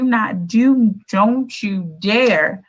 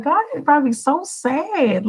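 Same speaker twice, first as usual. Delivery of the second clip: mock sad musing; 8 semitones higher than usual. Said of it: "I'll be like".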